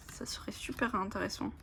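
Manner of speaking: whispered musing aside; soft whisper. Real, not acted. Musing about card use with viewers, soft whisper. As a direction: drop to a whisper as if thinking aloud.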